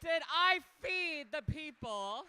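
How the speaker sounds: loud, drawn-out crowd hype; big stage MC call; hype host shout. Acted. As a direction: rev up the room like a drag host. Drag host hyping the crowd.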